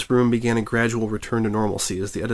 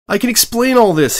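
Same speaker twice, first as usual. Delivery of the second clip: high, louder desperate plea; pleading; 12 semitones higher, 6 dB louder than usual. A narrator's reading. Reporter pleads with his raging editor, high and desperate. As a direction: beg to be heard, voice jumping up. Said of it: "he pleaded".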